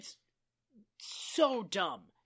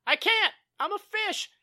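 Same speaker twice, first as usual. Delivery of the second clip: high-pitched mock protest; imitated high cry of protest; pleading back; 10 semitones higher, 4 dB louder than usual. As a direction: call it back helplessly, pitched way up. Fish shouting back to navy.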